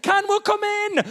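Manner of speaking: high, drawn-out acted plea; pleading call. Wife at the bathroom door, pleading.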